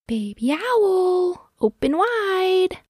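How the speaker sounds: high, slow coaxing mother voice; Mama Owl. A narrator's reading. Mother owl feeding her baby, high slow coaxing. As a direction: draw it out high to coax a baby. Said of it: "Mama Owl said as Baby Owl was first learning to eat".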